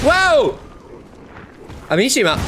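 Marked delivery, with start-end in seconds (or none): 0.0-0.6 high, loud startled shout; startled wow. Real, not acted. Gamer dodging the lunging monster, shouting wow. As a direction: yelp as the monster lunges.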